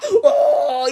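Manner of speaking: imitating a child's wailing cry; a crying child's wail, played for laughs; mock crying wail. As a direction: let out a hammed-up toddler howl. Retelling her childhood crying fits.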